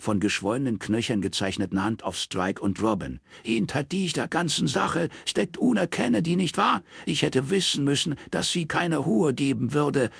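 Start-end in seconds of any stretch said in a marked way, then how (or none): 3.4-10.1 put-on high, strained voice of an aggrieved old man; an old father lashing out at the family; bitter old father. Wounded old father accusing his daughter's friend.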